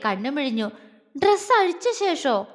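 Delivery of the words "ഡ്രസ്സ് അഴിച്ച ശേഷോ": much higher, wide-eyed disbelief; shocked surprise. Acted. Jwala echoing her friend's question, in shock. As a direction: stress on dress, echoed back in shock. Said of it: "അവളിൽ അമ്പരപ്പ്".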